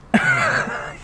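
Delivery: a short high squeal of a laugh; high-pitched laugh. Real, not acted. Laughs at cocaine joke.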